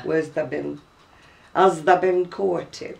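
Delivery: "Where's tha been?" in broad Oldham dialect, tha short and unstressed. Shown as a demonstration. Showing her friend the old Oldham way of speaking, broad Lancashire. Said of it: "In other words".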